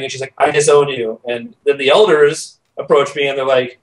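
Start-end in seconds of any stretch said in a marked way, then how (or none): none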